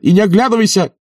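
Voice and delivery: very high urgent yell; frantic shouted order; shouting after her. A narrator's reading. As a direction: call to someone running away. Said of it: "кричал он ей вслед".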